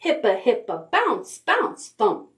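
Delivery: ordinary talk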